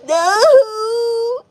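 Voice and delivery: high loud wailing no; hammed-up crying no; mock cry. Real, not acted. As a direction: wail it out. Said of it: "I'm gonna cry".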